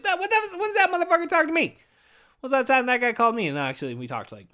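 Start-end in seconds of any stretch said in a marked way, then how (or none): 0.0-3.5 high, squeaky mock outrage; mock high squeal. Mock outburst about brother.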